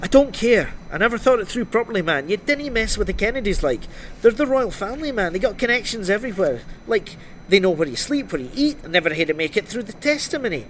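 high, worked-up protest; rattled man refusing to go on; agitated witness. Acted. Frightened witness refuses to testify.

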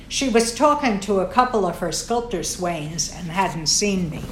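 ordinary delivery, nothing marked

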